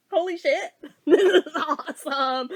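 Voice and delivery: slowed, loud, laughing delight; thrilled and laughing. Real, not acted. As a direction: gush it through a laugh. Gleeful reaction to movie.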